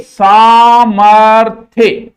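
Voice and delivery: सामर्थ्य loud and very slow, saa-mar-thya; drawn-out model pronunciation. Shown as a demonstration. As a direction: stretch every syllable of सामर्थ्य loudly for the class. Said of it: "बोलो बार बार मेरे संगे".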